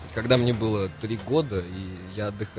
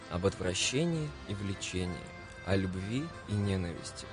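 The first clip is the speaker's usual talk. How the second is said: dramatic literary reading; 6 dB quieter than usual. Acted. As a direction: read it like a radio poem. Reads Süskind quote over music.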